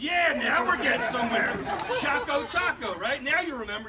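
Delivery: loud gotcha shout; triumphant shout. Retold or imitated. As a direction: nail him, you caught him out.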